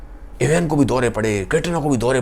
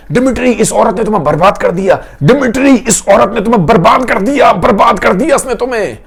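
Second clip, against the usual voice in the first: loud, much higher cry across the court; shouting; 7 semitones higher, 7 dB louder than usual. Acted. Grushenka yelling from the court benches at Dimitri, shouting. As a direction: yell it out to save him. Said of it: "वहीं से चिल्लाती है".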